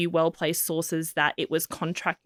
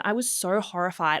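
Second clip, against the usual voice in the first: ordinary talk, nothing marked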